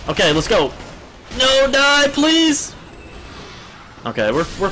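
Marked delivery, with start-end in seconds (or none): 1.4-2.7 high, loud wail at the enemy; desperate pleading. Real, not acted. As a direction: beg loudly for it to die. Pleading in a game fight.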